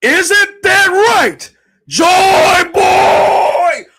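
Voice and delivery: drawn-out high, loud mock villain yell; villain scream. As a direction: scream it at Joy Boy like the villain, very slowly. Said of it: "Oh, he's screaming".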